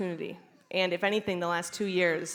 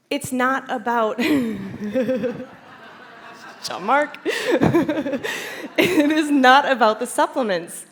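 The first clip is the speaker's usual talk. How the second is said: laughing; 5 semitones higher, 8 dB louder, slower than usual. Real, not acted. Laughing emphatic correction.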